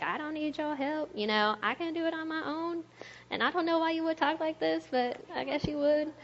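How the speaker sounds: high, mock stubborn voice; high mocking "I can do it on my own"; mock proud refusal. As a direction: mimic a prideful person brushing off help. Mocking someone refusing help.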